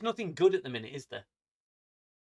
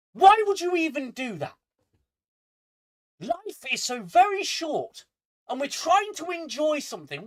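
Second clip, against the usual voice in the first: high, loud, outraged tirade; angry rant; 6 semitones higher, 6 dB louder than usual. Real, not acted. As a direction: rant at them with rising indignation. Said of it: "I am angry".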